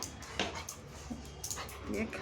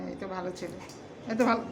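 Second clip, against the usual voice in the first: soft soothing; 7 semitones lower, 8 dB quieter, faster than usual. Real, not acted. Soothing the pet softly.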